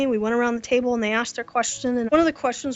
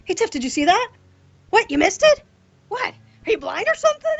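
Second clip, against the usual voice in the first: playful coworker ribbing, pitched much higher; comical teasing; 9 semitones higher, faster than usual. Retold or imitated. Coworker joking with blind Tiff, playful teasing. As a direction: tease her like an old friend joking. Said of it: "it's really more of a comical thing".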